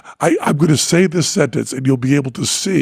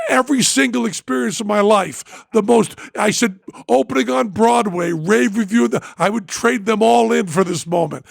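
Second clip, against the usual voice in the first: ordinary delivery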